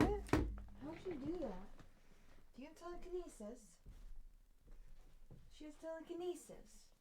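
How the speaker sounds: high, astonished pet talk; amazed. Real, not acted. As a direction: marvel at the kitten. Amazed at the kitten.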